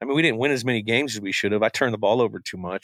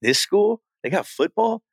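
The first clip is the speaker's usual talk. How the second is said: high, surprised disbelief; can't believe they play football; incredulous; 9 semitones higher than usual. Retold or imitated. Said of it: "I was like".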